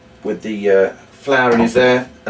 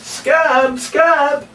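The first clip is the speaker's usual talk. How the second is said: calling out; 14 semitones higher than usual. Shown as a demonstration. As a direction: shout his name as if he's hiding far off. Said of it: "shouting for Scamp again".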